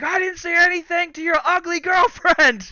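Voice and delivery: high, loud scream of outrage; reenacted furious screaming; screaming at him. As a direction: scream it back at a bully. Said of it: "screaming at him".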